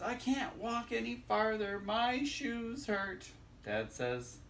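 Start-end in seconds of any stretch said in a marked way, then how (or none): 0.0-3.3 put-on higher, slow child's complaint; whiny little critter. Little critter complaining to dad on the walk, higher and slow. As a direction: stretch it out like a tired kid whining. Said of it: "Little critter says".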